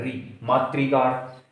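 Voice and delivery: slow teaching pronunciation of मातृकार; slow demo. Shown as a demonstration. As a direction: say री and मातृकार very slowly so the री is heard. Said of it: "ये री आता है".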